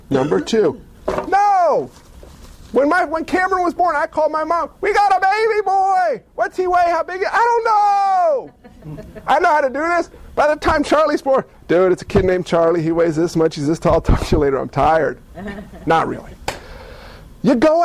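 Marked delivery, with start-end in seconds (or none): 1.3-1.9 high, drawn-out exclamation; emphatic refusal. Acted. Rejects the calm scripted announcement. 4.8-8.5 high-pitched, elated announcement; overjoyed new father. Acted. New father phoning mom with news. 11.7-16.3 low, deadpan, weary announcement; jaded new father. Acted. Jaded father announcing a later child.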